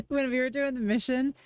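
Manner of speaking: ordinary talk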